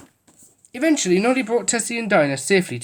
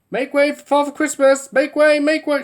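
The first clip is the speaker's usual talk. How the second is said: loud announcing call; shouted; 9 semitones higher, 4 dB louder than usual. A narrator's reading. Policeman clearing the crowd, shouting. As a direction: clear the road for the sleigh. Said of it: "shouted Mister Plod".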